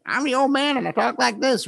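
high, put-on old man; old man radio voice. Shown as a demonstration. Demoing a distinct radio voice, cartoonish old man. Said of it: "the voices were often very distinct".

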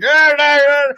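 high, loud mock gibberish; foreign babble imitation. The Hungarian host toasting with his drunk guest, loud high babble. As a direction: cheer in made-up Hungarian-ish noise. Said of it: "He's like".

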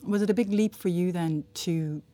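ordinary talk, nothing marked

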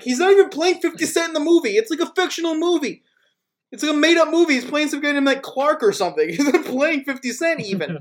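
fast, high, indignant ranting; retold furious rant. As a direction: rattle off the outrage at a clip, pitched high. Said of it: "I was furious".

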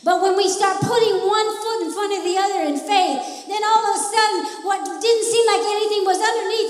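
loud, rising sermon cadence; full-force preaching. Acted. Preacher rallying the church to fight, loud preaching. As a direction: preach it out to the congregation.

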